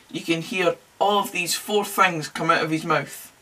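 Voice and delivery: normal talking voice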